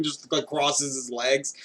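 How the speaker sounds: usual speaking voice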